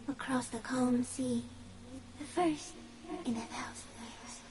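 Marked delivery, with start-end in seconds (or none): 2.2-4.4 breathy eerie whisper. Acted. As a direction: breathe it out as a secret. Phasmid revealing itself eerily.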